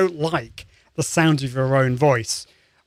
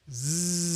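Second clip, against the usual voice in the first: soft, steady buzzing zzz held out; long steady buzz; sustained zzz; 12 dB quieter than usual. Shown as a demonstration. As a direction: hold a quiet even zzz on the breath. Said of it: "which is a zzz sound".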